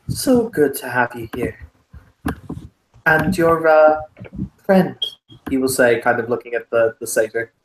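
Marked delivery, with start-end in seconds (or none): 0.1-5.0 courteous Irish lord; broad Irish, politely hesitant; thickest Irish accent. Acted. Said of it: "the thickest Irish accent".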